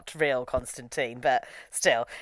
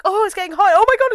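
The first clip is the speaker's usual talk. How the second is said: much higher, hammed-up panic; mock panic; 13 semitones higher, 4 dB louder, faster than usual. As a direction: squeal like a hammy actress. Characters burning up.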